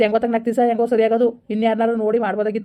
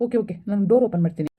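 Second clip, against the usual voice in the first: usual speaking voice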